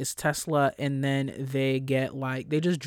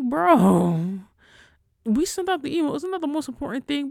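loud, higher, exasperated; exasperated retort; 11 semitones higher, 5 dB louder than usual. Retold or imitated. Answering panicking colleagues, exasperated and higher. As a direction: push back, fed up. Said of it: "I'm like".

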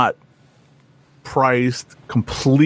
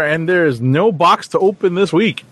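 normal talking voice

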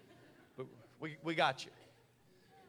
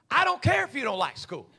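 stern loud father; 6 semitones higher, 11 dB louder than usual. Retold or imitated. Dad ordering his daughter back to study, loud and stern. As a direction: bark it like a no-nonsense dad. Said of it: "It was more similar to".

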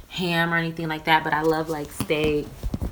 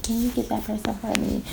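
playful put-on voice; change your voice up for a mock request; changed-up voice; 4 semitones higher than usual. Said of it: "I just wanted to change my voice up".

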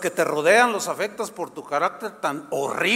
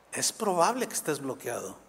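quiet aside; 6 semitones lower, 6 dB quieter than usual. Shown as a demonstration.

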